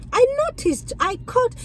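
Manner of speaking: high-pitched imitation of a nosy passenger; curious passenger. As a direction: mimic a customer who just noticed something. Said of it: "will put it like this".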